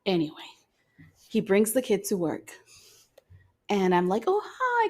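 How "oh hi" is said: much higher, soft sugary "oh, hi"; sweet high greeting. Retold or imitated. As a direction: coo at the cute kid.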